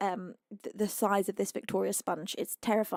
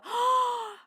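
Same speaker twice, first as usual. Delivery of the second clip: sharp high gasp; 12 semitones higher than usual. Real, not acted. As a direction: gasp as if you can't believe it. Reacting to level seven.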